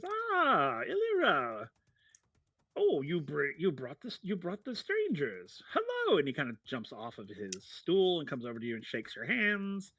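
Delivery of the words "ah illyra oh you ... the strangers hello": high, excitable little tinkerer; Feldwin. Acted. Eccentric halfling greeting the strangers, high and excitable. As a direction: swoop up high in delighted greeting. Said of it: "And he goes".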